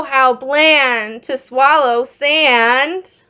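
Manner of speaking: ordinary talk, nothing marked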